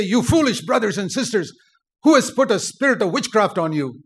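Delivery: forceful, indignant preaching; stern preacher. Acted. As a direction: scold the congregation as a preacher. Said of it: "if you heard a preacher stand up".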